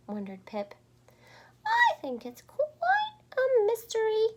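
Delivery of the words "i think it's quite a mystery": very high, loud chirp; high loud chirping bird. Acted. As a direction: chirp it brightly. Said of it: "Flap chirped".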